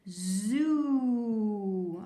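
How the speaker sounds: "Zoo" held long and loud on the oo; stretched long oo. Shown as a demonstration. Teacher modeling zoo's ooh sound for kids. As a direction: draw out the "oo" in "Zoo". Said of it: "That has that ooh sound".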